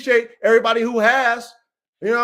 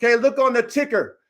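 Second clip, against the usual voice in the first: ordinary talk, nothing marked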